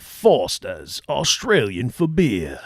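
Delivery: slow, low mock Australian ad voice on Foster's; hammy beer-commercial Aussie read, slowed down; ad tagline. Host quoting the old American Foster's TV ad, slow mock Australian. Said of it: "they did a commercial that said".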